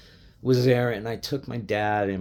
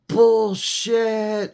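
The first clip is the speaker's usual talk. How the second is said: dad's outraged yell, much higher and louder; retold shout; 13 semitones higher, 7 dB louder than usual. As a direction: bark it like an angry old fan at a bad call. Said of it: "my dad literally going".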